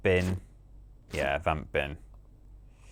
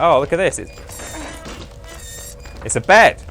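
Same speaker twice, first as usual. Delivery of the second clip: mock surprised discovery; 8 semitones higher, slower than usual. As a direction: act out a player suddenly spotting a way out. Voices player's sudden discovery.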